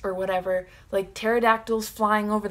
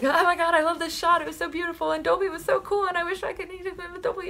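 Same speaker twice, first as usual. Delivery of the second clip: much higher, breathless fangirl rush; gushing excitement; 10 semitones higher, faster than usual. Retold or imitated. Fan rambling to her mom after the movie, excited gush. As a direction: gush like you just left the theater. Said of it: "I get really passionate and excited".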